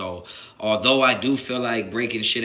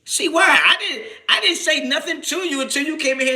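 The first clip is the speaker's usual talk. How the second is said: much higher, laughing exasperation; exasperated; 3 dB louder than usual. Real, not acted. Streamer defending himself to chat, high exasperation. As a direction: sputter in disbelief.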